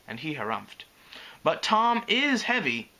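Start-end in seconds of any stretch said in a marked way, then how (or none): 1.4-2.9 put-on high, slow childish grumble; grumbling. A narrator's reading. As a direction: complain like a put-upon kid. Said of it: "he grumbled".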